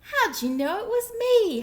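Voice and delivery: high, loud howl; turkey howling, caught again. A narrator's reading. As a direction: howl it in despair. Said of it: "howled Turkey".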